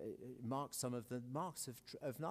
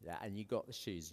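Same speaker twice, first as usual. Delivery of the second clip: normal talking voice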